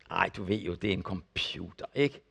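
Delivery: a low, tired shrug of a reply; dismissive husband. Retold or imitated. Husband brushes off wife again. (lav, afvisende svar til konen)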